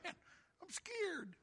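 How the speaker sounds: put-on high, small child's voice; mock a little kid's frightened whine; scared little kid. Preacher playing a kid afraid of the dark, scared little voice. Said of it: "That's what the little kid said".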